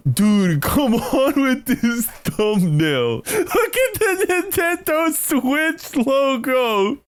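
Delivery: high, slow, cracking with laughter; laugh-crying. Real, not acted. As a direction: whine it through helpless laughter.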